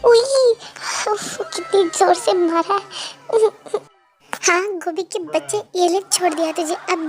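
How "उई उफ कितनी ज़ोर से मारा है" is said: mock pain. Brother being beaten.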